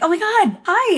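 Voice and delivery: high, loud mock gasp of hello; surprised greeting. Greeting the fan, high and loud. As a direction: act caught off guard but thrilled. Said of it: "and I'm like".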